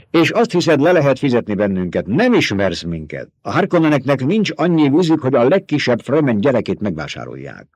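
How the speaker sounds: scornful fighter rejecting a bribe; proud Fremen warrior. A narrator's reading. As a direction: Fremen warrior scoffing at being bought.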